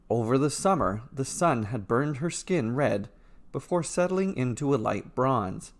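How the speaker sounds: ordinary delivery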